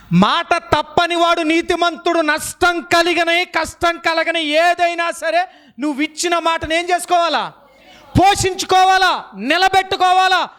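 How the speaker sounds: high, slow, driving sermon climax; preaching at full force. Acted. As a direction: hammer the point home to the congregation. Preaching climax, pitch raised. (ఉద్వేగంగా బోధిస్తూ, నెమ్మదిగా, ఎత్తైన స్వరంతో)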